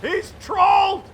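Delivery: long loud cry then high shouted groan; dismayed cry. Real, not acted. Commentator crying out as his hero gets killed. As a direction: wail at the sudden loss.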